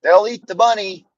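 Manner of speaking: grandma. Retold or imitated. Grandma calmly telling the crying boy. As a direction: shrug it off like a country grandma. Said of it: "Grandma's like".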